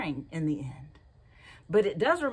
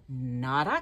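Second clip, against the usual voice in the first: lazy duck; 8 semitones lower than usual. Acted. Duck's refusal voiced low.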